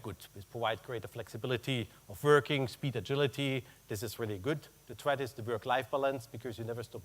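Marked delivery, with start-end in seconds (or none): none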